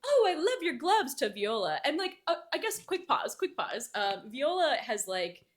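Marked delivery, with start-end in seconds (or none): 0.0-1.2 high, louder mock gush. Lady Miranda to Viola about gloves, gushing. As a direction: voice an over-eager compliment, pitched up.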